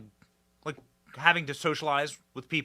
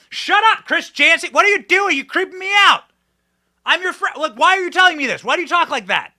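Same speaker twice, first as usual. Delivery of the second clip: exasperated shout; 13 semitones higher, 13 dB louder than usual. Acted. Yelling at the mocked anchor.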